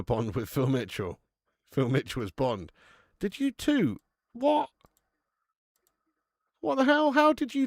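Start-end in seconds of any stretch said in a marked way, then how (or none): 3.2-7.7 amazed. Real, not acted. Chat comments match, streamer amazed.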